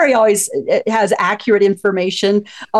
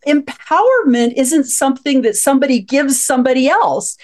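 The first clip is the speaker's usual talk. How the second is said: normal talking voice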